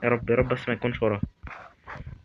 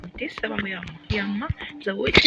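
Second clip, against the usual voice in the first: mock falsetto lament; 8 semitones higher, 4 dB quieter than usual. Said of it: "high falsetto lament".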